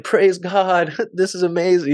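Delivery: gleefully praising God through laughter; overjoyed. Retold or imitated. Joyful reaction to no bond.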